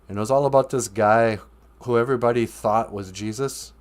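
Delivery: ordinary talk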